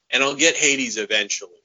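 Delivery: normal talking voice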